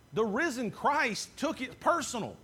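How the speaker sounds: ordinary talk, nothing marked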